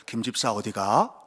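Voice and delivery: a hint of a low, slow divine voice; lightly voiced God calling out; God's voice. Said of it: "갑자기 음성이 들리는 거예요". (하나님 음성을 살짝 낮고 느리게)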